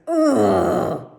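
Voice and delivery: loud exasperated ugh; frustrated groan. Real, not acted. Venting about a missed appointment, loud frustrated ugh. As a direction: let out a loud fed-up groan. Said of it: "I'm so mad right now".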